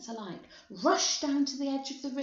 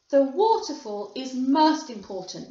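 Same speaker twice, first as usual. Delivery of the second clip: lightly voiced, emphatic factory owner; emphatic showman; 9 dB louder than usual. A narrator's reading. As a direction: stress it loudly like a proud host. Wonka showing off his factory.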